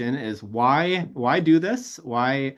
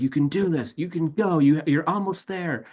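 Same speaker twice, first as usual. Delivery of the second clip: higher-pitched, urgent urging; encouraging coach; 8 semitones higher than usual. Retold or imitated. Retelling a film coach down beside a struggling player, urgent encouragement. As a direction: imitate a coach willing his player on. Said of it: "he's getting down to his level".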